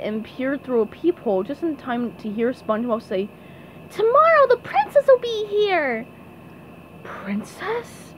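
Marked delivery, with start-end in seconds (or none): 3.9-6.0 high, loud cartoon announcement; SpongeBob shouting. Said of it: "Mister Krabs heard SpongeBob shouting". 7.0-8.1 hushed, voiceless whisper of a question; Mister Krabs whispering. Acted. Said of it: "whispered Mister Krabs".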